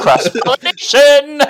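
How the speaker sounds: high, loud gleeful cry; excited shout. Real, not acted. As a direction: blurt it out with delight. Excited shout about crossover.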